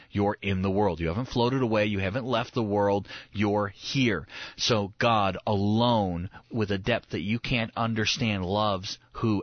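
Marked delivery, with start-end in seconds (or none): 4.6-6.3 slow emphasis. Shown as a demonstration.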